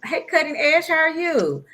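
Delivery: high, bright social media hello; upbeat greeting voice. Retold or imitated. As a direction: greet followers like an influencer. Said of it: "I have to interact with people".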